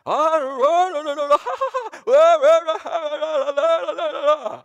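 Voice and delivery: big high bragging laugh, imitating the friend; boastful laugh. Imitating a Korean friend's boastful laughter about foreclosure. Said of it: "bragging about himself from the intonation".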